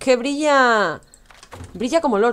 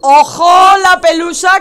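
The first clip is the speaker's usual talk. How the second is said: alarmed shout; 9 semitones higher, 10 dB louder than usual. Real, not acted. Warning about the fluff.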